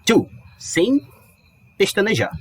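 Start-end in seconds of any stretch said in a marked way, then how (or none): 0.1-0.3 "To" said short and dry, no drawl. Shown as a demonstration. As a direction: snap out a short "To". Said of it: "bem seco assim".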